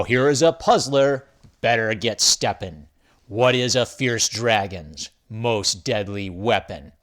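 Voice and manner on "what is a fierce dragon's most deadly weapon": usual speaking voice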